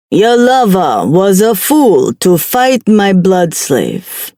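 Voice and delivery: slow Jamaican demon voice; lightly voiced Jamaican accent. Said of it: "He spoke with a thick Jamaican accent".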